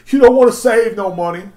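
"You don't wanna save no money" said high and loud; loud, high mocking repeat. Shown as a demonstration. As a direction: punch it up the second time, loud. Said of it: "repeated louder".

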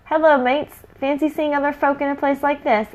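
high, breezy casual greeting; nonchalant. Acted. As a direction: play it cool and cheery. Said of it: "as nonchalantly as possible".

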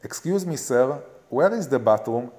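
English with a heavy Israeli accent. Shown as a demonstration. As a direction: spell it out the Israeli way. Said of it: "דברו אנגלית ישראלית".

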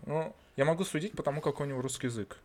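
ordinary delivery

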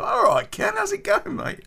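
high, chummy mock hello; mock cheery greeting. The new guy greets Ken cheerfully, high mock greeting. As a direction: play him breezy and overfamiliar. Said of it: "put his arm around my shoulder and go".

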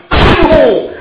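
anguished cry. Acted. Father finds his daughter dead.

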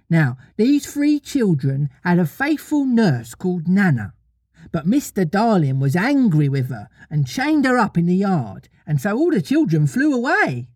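high, lilting little-mother storyteller; childlike storytelling voice; Wendy telling a story. Acted. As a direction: pitch it up, telling children a tale. Wendy tells her story.

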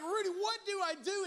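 high, loud panicked plea; freaking out. Retold or imitated. Dog sitter begging the owner for help, freaking out. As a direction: plead loudly in comic panic. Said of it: "So I'm freaking out".

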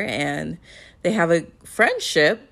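normal talking voice

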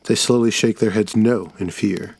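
ordinary delivery, nothing marked

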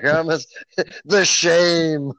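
loud, high, hammed-up wail; mock anguish. Cohost plays singer ashamed of his hot dog, mock anguish. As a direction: cry it out in comic shame.

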